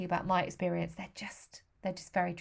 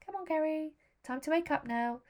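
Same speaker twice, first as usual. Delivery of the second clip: nurse's waking call; 8 semitones higher than usual. Retold or imitated. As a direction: coax a patient gently awake. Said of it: "the voice of a nurse".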